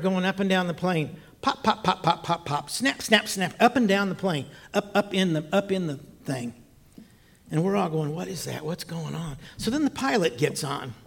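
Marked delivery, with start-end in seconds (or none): 1.4-3.5 short percussive words in even bursts; imitated popping noise. Storyteller mimics noise along the plane, percussive repeats. 8.1-9.4 much softer, low-key questions; hushed wondering. Retold or imitated. Passengers ask each other about odd noise, hushed.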